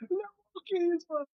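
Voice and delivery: high, hammed-up French "Le wokisme"; mock French. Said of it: "quote tweeting, going".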